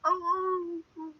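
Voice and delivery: high, drawn-out hound moan; dog's whine. Retold or imitated. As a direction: imitate a hound whining in repeated bursts. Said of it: "he would whine".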